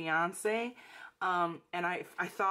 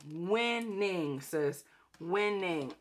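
usual speaking voice

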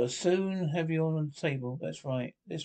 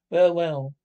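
loud sarcastic well well; 9 dB louder than usual. Acted. Captain addressing hungover crew.

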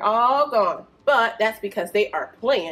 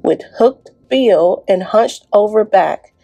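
louder, lively rhyming read-aloud; reading to children; 9 dB louder than usual. Acted. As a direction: bounce through the rhymes for the kids. Reads rhyming picture book aloud.

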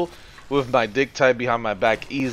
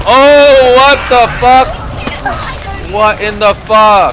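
high, loud what the fuck; real shock, yelled at the screen; blurting out alarm at a scary noise; startled outburst; 10 semitones higher, 10 dB louder, slower than usual. Real, not acted. Startled by something in game.

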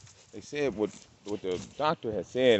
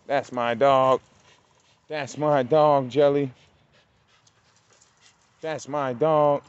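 loud, drawn-out praise for the dog; proud dog praise; 4 semitones higher, 6 dB louder, slower than usual. Real, not acted. Owner praising his dog Jelly, loud and proud. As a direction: cheer her on like a proud owner.

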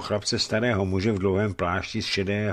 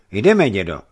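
louder, decisive call; brisk, firm; 9 dB louder than usual. Acted. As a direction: say it firmly, ready to go. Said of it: "řekl rázně Dmitrij".

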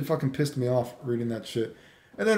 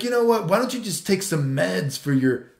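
higher-pitched indignant imitation, slowing at the end; mock scolding; 4 semitones higher, 3 dB louder than usual. Old dude scolding him on Facebook, mock indignation. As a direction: imitate a huffy telling-off, drawing out the final words.

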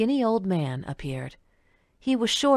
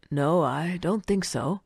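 put-on slow old man voice; slower than usual.

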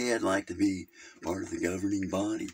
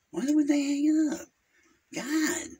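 exasperated outcry; 5 dB louder than usual. Acted. Caller outraged at being hung up.

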